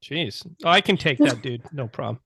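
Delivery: ordinary talk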